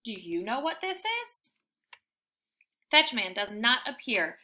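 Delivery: ordinary delivery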